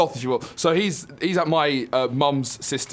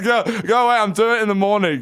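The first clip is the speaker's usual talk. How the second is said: louder, much higher mock grumble; hammed-up drunk pushing her away; grumpy drunk refusal; 7 semitones higher, 5 dB louder than usual. Daughter playing her naked drunk dad refusing to leave bed, raised mock grumble. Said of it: "He's like".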